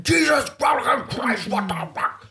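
high, shouted Jesus then falling off; mock angry dad outburst. Son imitates his father's angry swearing at injuries, mock yell. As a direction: ham up a dad swearing at a hurt kid. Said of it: "he's like".

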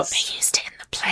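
fast breathless whisper. Real, not acted. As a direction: hush it like gossip. Whispered repeat.

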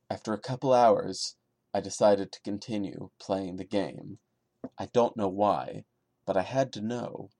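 steady, subdued creepy-story reading; story narration. A narrator's reading. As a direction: read it straight like a campfire tale. Reads creepypasta story aloud.

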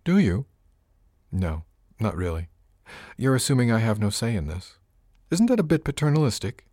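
usual speaking voice

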